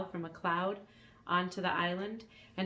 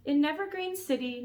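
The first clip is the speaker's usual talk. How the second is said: put-on young boy voice; 9 semitones higher, 4 dB louder than usual. Elmer voiced in story.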